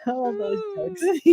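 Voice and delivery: high, wheezy laugh-cry; laughing till crying. Real, not acted. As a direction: laugh so hard it cracks.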